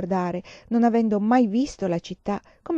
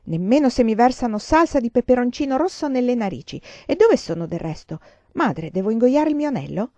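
put-on boasting child; 5 semitones higher, 5 dB louder than usual. A narrator's reading. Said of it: "si vantò Giada Lucente".

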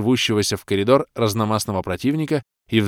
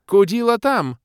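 high, louder, silly elf tone; 13 semitones higher, 5 dB louder than usual. A narrator's reading. Bald elf sending the demoness away, high silly voice. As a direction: point her off cheerfully.

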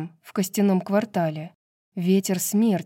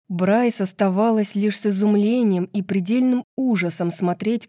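plain reading voice

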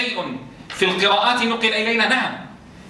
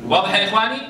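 ordinary delivery

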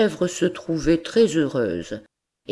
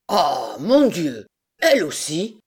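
stunned cry; 2 semitones higher than usual. Acted. Monsieur Seguin hears his goat wants to leave, stunned cry. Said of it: "cria monsieur Seguin stupéfait".

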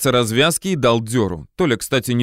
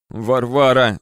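ordinary delivery, nothing marked

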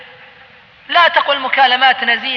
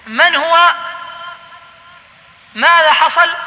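alarmed question; 6 semitones higher, slower than usual. Retold or imitated. Young man startled by the religious police, alarmed. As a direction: blurt it out in sudden worry.

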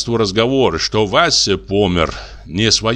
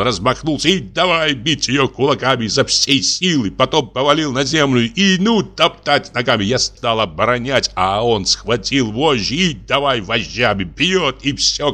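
plain reading voice

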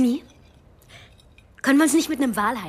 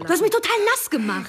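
empört; 9 semitones higher than usual. Acted. Wird mit Sprudel nassgespritzt.

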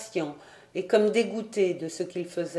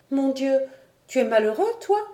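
higher-pitched daughter, startled question; distressed exclamation; 6 semitones higher than usual. A narrator's reading. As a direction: cry out in concern for your father. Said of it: "s'écria Diane en se jetant dans ses bras". (exclamation inquiète)

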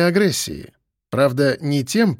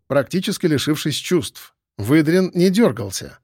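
ordinary delivery, nothing marked

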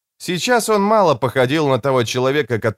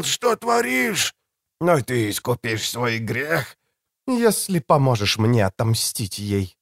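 higher, taut woman's voice; tense female voice; 7 semitones higher than usual. A narrator's reading. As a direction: tight as a bowstring. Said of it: "женским, однако звучавшим напряжённо".

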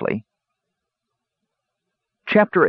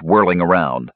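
ordinary delivery

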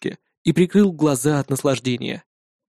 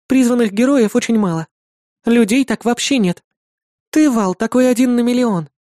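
put-on high bright girlish voice; young woman voice; 8 semitones higher than usual. A narrator's reading. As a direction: voice the demon girl cheerfully. Irda, louder.

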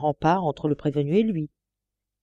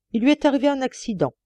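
hurried; 8 semitones higher, 3 dB louder, faster than usual. A narrator's reading. Judge explains quickly.

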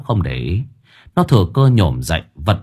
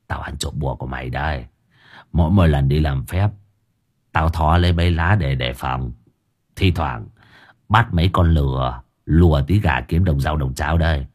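put-on sly confiding old rascal; 6 semitones lower than usual. A narrator's reading. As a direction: own up slyly under your breath. Old man confides his theft.

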